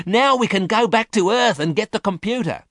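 high-pitched, joyful exclamation; excited cry. A narrator's reading. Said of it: "she cried excitedly".

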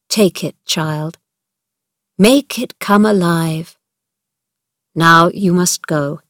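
slow, tender, tired uncle; gentle and kind. Acted. A kind uncle granting Mary her earth, slow and tender.

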